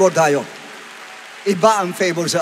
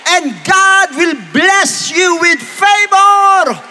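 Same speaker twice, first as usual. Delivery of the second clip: high, loud, slow proclamation; full-force preaching; 13 semitones higher, 9 dB louder, much slower than usual. Real, not acted. Preacher promises God's blessing, full-force preaching. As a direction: stretch and shout each word to the crowd.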